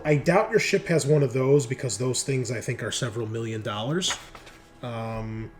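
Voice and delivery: ordinary talk, nothing marked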